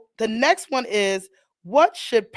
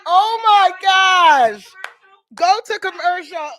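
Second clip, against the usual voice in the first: laughing call; 8 semitones higher, 4 dB louder, slower than usual. Real, not acted. Laughing, calling to co-host.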